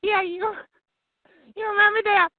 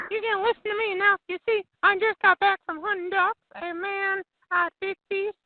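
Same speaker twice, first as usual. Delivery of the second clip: ordinary talk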